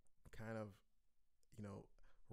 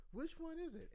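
high quiz. Real, not acted. Teacher quizzing with high voice.

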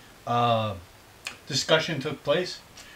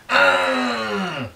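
soccer fan yell; 6 semitones higher, 4 dB louder than usual. Retold or imitated. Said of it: "the guy from Revolution".

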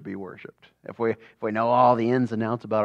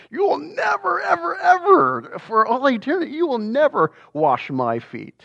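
high shouted mock Peter, stretching the ever; mock-shout the nevers like stubborn Peter; emphatic never; 4 dB louder than usual. Teacher playfully shouts Peter's refusal to the class, hammed-up and loud. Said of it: "that never is really emphatic".

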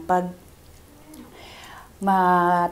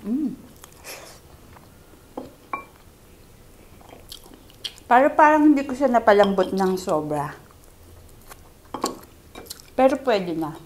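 talking while chewing; eating between words; mouth full; 3 semitones higher than usual. Real, not acted. Seen 0: Eating ribs, chewing, hand to mouth while speaking.

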